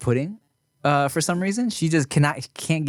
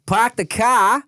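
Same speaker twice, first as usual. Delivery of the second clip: Boston accent; 9 semitones higher, slower than usual. Shown as a demonstration. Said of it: "Boston is".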